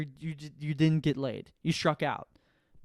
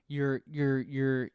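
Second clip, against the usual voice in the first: ordinary talk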